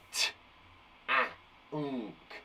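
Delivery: each sound said apart with pauses; sounded out letter by letter. Shown as a demonstration. Teacher repeating the sounds for the class. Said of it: "stamp on those sounds".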